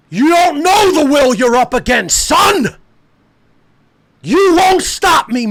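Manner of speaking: high, loud, drawn-out yells; screaming defiance at big tech; defiant shouting. Real, not acted. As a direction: roar defiance at them. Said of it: "I can say it like that".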